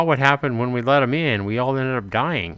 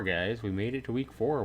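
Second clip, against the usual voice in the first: normal talking voice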